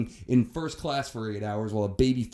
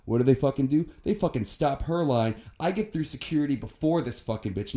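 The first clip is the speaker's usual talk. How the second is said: ordinary talk, nothing marked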